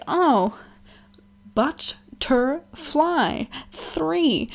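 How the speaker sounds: put-on high, slow and hesitant child; mock small child answering; timid little kid. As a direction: sound out the word like a small kid. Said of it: "And I was like".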